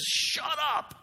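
high snapped command to silence someone; sharp shut up. Retold or imitated. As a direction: cut off the panic with a slap of words. Somebody silences a screaming person.

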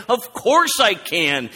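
high, drawn-out, obvious-as-day retort; duh tone. Retold or imitated. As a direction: act out Jesus stating the obvious. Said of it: "It's a duh moment".

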